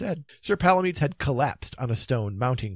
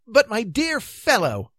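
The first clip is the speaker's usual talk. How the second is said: high, drawn-out plea; protesting king; 11 semitones higher, 8 dB louder than usual. Acted. King answering an angry knight, protesting. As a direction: object in a high, hurt voice.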